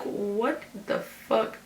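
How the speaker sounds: slow, hushed, baffled reaction; confuzzled. Retold or imitated. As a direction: drag it out quietly, replaying your confusion. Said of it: "I'm reading this completely confuzzled".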